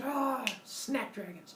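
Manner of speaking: high, slow groan of exasperation; hammed-up thwarted god; mock frustration. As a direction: drag out a mild curse. Zeus thwarted again.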